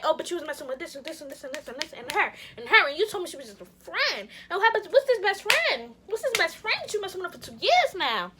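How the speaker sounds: high, loud rapid-fire accusations; going off. Retold or imitated. Girlfriend bringing up other girls to her man, going off angrily. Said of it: "I started going off".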